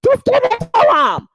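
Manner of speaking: high, loud, whiny put-on voice; mocking Jasmine. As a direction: mimic a lazy mom waving it off. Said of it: "she's like".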